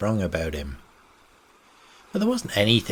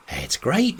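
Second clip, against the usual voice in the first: pitched higher, eager exclamation; exclaimed; 7 semitones higher than usual. A narrator's reading. Young Bailey to Captain Hawser, excitedly exclaiming. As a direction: burst out with delight at the plan. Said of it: "he exclaimed".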